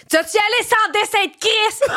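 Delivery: impatient driver's mock yell; car yelling. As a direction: yell it at the car ahead, hammed up. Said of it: "je crie de même dans mon char".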